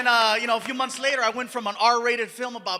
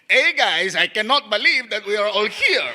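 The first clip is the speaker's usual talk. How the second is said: louder, accented cartoon read; Mexican accent take; 5 dB louder than usual. Shown as a demonstration. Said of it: "take two".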